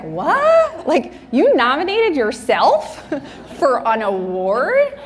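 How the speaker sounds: sudden high, stunned "What?"; mock shock at someone's nerve, half laughing; shocked disbelief. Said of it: "her face was exactly like everybody".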